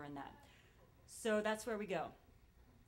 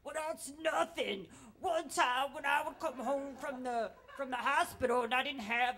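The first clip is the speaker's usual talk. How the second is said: put-on loud, higher, haggard old-timer voice; amped-up smoky hostess; 3 semitones higher, 8 dB louder than usual. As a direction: top their story, building to a big loud finish. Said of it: "got, like, really amped up".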